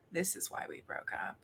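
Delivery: barely voiced, very soft whisper; hushed whisper under the breath; whispered aside. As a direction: whisper it to your ex as you back down. Acted aside after backing down.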